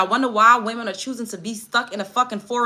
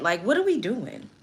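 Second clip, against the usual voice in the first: usual speaking voice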